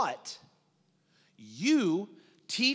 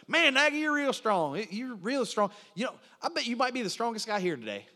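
Hillbilly; 2 semitones higher than usual. Retold or imitated. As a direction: imitate a rural buddy's admiring hillbilly talk. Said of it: "This is how he talks".

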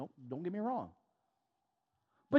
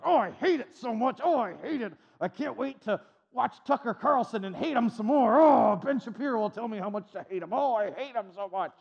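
mock whiny complainer. Imitating outraged Christians watching news.

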